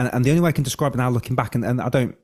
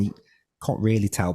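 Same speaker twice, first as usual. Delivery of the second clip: ordinary talk, nothing marked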